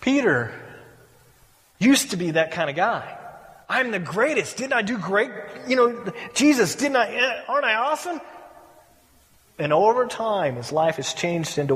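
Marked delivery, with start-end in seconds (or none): 3.7-8.2 preening, self-satisfied, fishing for praise; mock boastful brag. Peter boasting to Jesus, mock boastful preening. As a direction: play the braggart begging for applause.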